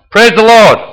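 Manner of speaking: high, loud shouted command. Acted. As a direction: shout it to set a donkey moving. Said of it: "and he shouts".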